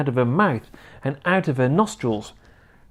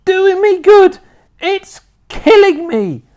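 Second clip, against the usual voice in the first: high, loud crone scream; 9 dB louder than usual. A narrator's reading. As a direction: scream in agony. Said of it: "she screamed".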